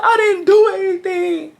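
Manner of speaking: high-pitched mock-tearful wail; crying denial. Teen outside pleading innocence to mom, mock crying.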